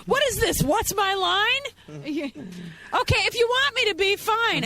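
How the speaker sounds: high-pitched, hammed-up huffy protest; mock indignation. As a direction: act put out, pitch way up. Guest feigns offence at host's question.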